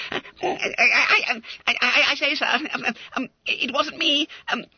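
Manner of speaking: very high, loud stammering plea; a terrified schoolboy begging; frightened pleading. Acted. Boy pleading innocence to his master, high frightened stammer.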